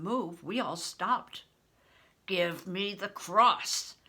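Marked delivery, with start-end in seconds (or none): 2.3-4.0 loud, slow demand. A narrator's reading. Steward demanding the cross from Crispin at the door. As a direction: insist on it, leaving no room. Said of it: "Acliff demanded".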